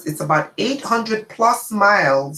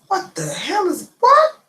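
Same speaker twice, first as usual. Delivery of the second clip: shocked disbelief; 13 semitones higher than usual. Retold or imitated. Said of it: "I'm saying to myself".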